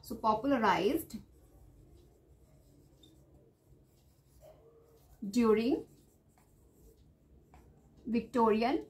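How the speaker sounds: drawn-out words, long pauses between them; word-by-word dictation. Real, not acted. Teacher writing out notes for exam students, word-by-word dictation. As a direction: say it piece by piece while writing.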